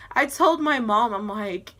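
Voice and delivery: ordinary delivery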